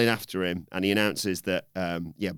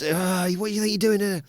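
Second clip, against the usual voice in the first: raised-pitch telling off; mock Pearce; 8 semitones higher than usual. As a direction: scold him in a higher voice. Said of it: "and he's".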